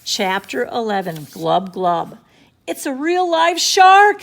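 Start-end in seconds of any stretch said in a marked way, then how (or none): 2.7-4.2 high, loud slow yell. A narrator's reading. Beachgoer spotting the shark, yelling loud and slow. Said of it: "somebody yelled".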